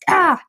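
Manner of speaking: high, short mock "ah" of overload; retold outburst cry. Vlogger mimicking her frazzled system, mock outburst. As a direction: imitate a system going haywire in one yelp. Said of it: "my system was like".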